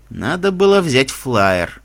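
slow, thoughtful inner voice; musing detective. Acted. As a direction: muse to yourself slowly. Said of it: "продолжал размышлять детектив".

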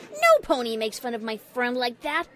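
loud, fierce defence of a friend; angry shout. A narrator's reading. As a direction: warn off the bully loudly. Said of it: "shouted Rainbow Dash".

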